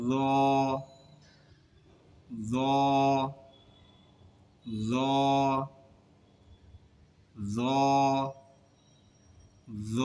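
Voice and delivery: জ as a heavy, full-mouthed "zaw", tongue tip at the teeth; thick, heavy za. Shown as a demonstration. Teacher drilling the letter জ, thick and heavy. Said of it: "জটাও একটু মোটা আওয়াজে হবে".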